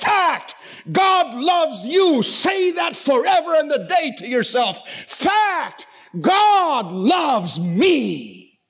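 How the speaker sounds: high, ringing climax with drawn-out words; impassioned, stretched-out declaration; preaching at full force. Real, not acted. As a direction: proclaim it to the whole congregation.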